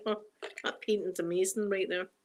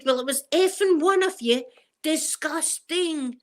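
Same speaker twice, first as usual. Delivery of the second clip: imitated Edinburgh mum, loud high outburst; hammed-up Edinburgh scolding, last word drawn right out; Edinburgh accent; 6 semitones higher, 4 dB louder, slower than usual. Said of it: "that's the Edinburgh accent".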